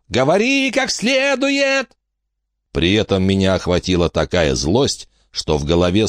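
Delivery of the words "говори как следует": slow, very high-pitched scolding; angry order. A narrator's reading. Frankie beating Bronwyn with her wand, angry high order. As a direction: demand it in a high, drawn-out cry. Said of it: "принялась колотить её своим жезлом".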